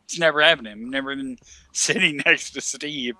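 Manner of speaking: ordinary talk, nothing marked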